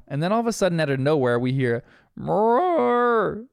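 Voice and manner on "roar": high, drawn-out playful roar; long pretend-beast noise, pitched up; Bandit's wild pig roar. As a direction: imitate a dad playing a wild pig with a lilting roar. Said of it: "classic Bandit lilt all over it".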